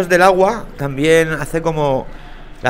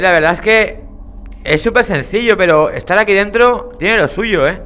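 ordinary talk, nothing marked

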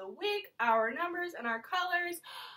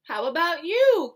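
ordinary talk, nothing marked

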